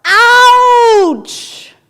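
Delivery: loud high yelled ouch; child yells. Acted. Child yelling ouch in the woods, loud and high. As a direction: belt it out like a hurt kid. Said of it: "the child yells out".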